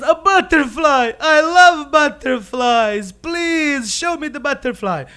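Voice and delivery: loud, high, gushing excitement; hammed-up thrilled begging; overjoyed bear. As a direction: squeal with childlike delight, stretching the words. Bear thrilled about the butterfly.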